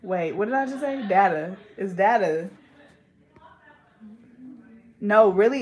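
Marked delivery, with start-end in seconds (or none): 1.1-2.5 data as DAT-a; short A in data. Shown as a demonstration. Settling how to say it, data as DAT-a. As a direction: say data with a short first vowel. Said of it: "Is it data or data?".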